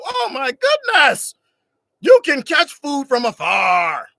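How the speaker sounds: high, slow, loud amazed outcry; excited wonder. Retold or imitated. He acts discovering bow and arrow, slow loud amazement. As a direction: marvel at it like a caveman seeing a miracle. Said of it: "I'm getting excited".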